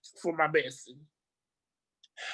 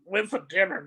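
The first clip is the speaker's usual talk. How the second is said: normal talking voice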